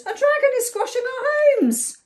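high, loud storybook outcry; high-pitched indignant alarm; alarmed cry. A narrator's reading. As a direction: wail it in acted panic. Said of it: "cried the animals".

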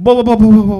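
loud, rapid burst of alarm; urgent calling out. Retold or imitated. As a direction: blurt it out like a dad rushing over. Dad rushing to crying daughter.

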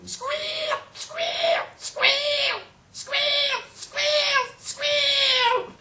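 very high, loud pig squeal; imitated pig squealing at full pitch. A narrator's reading. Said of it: "listening to him squealing".

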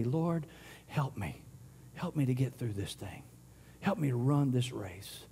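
soft, low plea to the Lord; quiet prayer. Retold or imitated. Believer asking God for help, softly and humbly. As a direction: pray it gently, almost under the breath.

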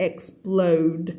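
"explode" with k, s, p and l all sounded; full cluster. Shown as a demonstration. Teacher giving students the right form, "explode" with full cluster. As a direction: finish on a carefully articulated "explode". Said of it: "ต้องเป็น explode".

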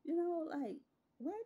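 high, loud disbelief; exasperated. Real, not acted. Telling followers about the boyfriend, loud and incredulous. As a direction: throw up your hands, incredulous.

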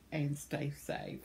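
ordinary delivery

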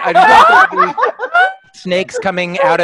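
high squeal of delight through a laugh; squealing excitement. Real, not acted. To the artist, squealing with glee. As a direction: squeak it out, giddy.

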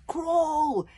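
loud, high command; urgent panicked yell. A narrator's reading. Wish orders escape from the monster, yelling. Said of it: "yelled Wish".

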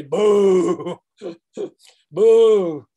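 loud, playful crowd boo; mock booing. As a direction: boo like rival fans. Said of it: "the Auburn fans say".

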